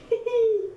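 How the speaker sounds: high delighted giggle; little laugh of delight; giddy laugh. Real, not acted. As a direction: burst into a happy giggle. Laughs over the card.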